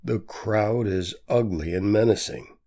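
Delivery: ordinary delivery, nothing marked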